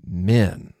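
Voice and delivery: ordinary delivery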